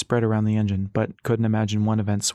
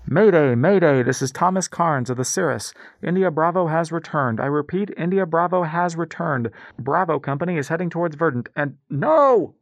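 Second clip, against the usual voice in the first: urgent, higher radio distress call; mayday radio call; 6 semitones higher than usual. Acted. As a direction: call for help over crackling radio.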